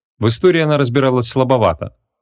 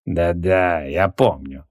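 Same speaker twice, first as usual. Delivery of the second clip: low, quieter self-satisfied wizard; a smug low chuckle of a reply; 5 semitones lower, 3 dB quieter, much slower than usual. A narrator's reading. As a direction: say it with a pleased smile. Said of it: "расплылся в довольной улыбке Креол".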